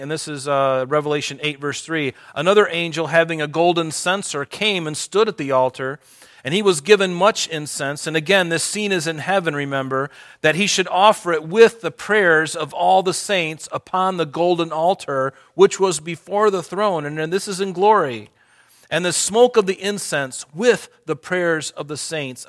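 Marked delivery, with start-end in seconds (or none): 2.3-8.1 loud, raised reading of Revelation, slowing at the end; forceful scripture reading. Acted. Preacher reads Revelation aloud. 10.4-22.4 loud, raised reading of Revelation, slowing at the end; forceful scripture reading. Acted. Preacher reads Revelation aloud.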